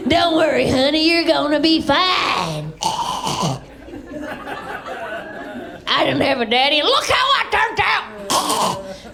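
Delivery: high, drawn-out smoker waitress voice; trailer park waitress. Acted. Waitress comforts a child left alone, raucous trailer park voice. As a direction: comfort a kid through a wheezy cackle. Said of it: "does that Trailer Park Nightmare laugh".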